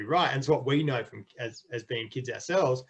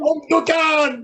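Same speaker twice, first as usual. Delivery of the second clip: high, stretched-out Dutch-style yell; hammed-up loud Dutch announcement; mock Dutch shout; 13 semitones higher, 6 dB louder, slower than usual. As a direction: bellow it slowly in a pretend Dutch accent. Shouts the mock-Dutch line again.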